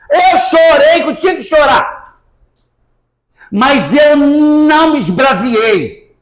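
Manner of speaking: high, forceful self-defence; emphatic retort. Retold or imitated. Encena resposta indignada a quem perguntou. (retrucando com veemência, voz aguda)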